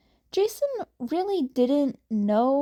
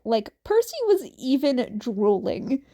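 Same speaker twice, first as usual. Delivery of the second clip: plain reading voice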